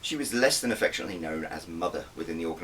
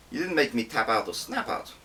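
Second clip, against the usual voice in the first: winking tease; 5 semitones higher, 4 dB louder than usual. Acted. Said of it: "I winked at him".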